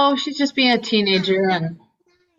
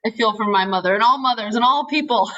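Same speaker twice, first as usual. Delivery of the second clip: ordinary talk, nothing marked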